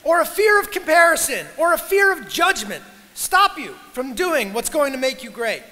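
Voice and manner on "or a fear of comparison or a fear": loud, higher, commanding call to attention; rallying shout. Real, not acted. Speaker demanding the graduates listen up, rallying shout.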